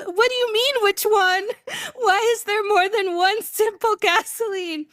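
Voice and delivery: high acted-out protest with a laugh; hammed-up bafflement, pitched high; exasperated disbelief. Driver questioning the attendant, high retold disbelief.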